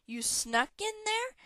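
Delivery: shrill, drawn-out disbelieving question. A narrator's reading. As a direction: shoot the pitch way up, slow and incredulous. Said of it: "Hera's shrill voice echoed".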